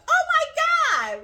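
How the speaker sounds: loud, high, drawn-out thrilled cry; first impression. Woman showing her first reaction to the ring, thrilled cry. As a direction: stretch it out in high-pitched excitement. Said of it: "that was my, you know".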